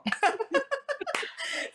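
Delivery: a long, high, delighted laugh; a big real laugh. Real, not acted. Laughing at her own line.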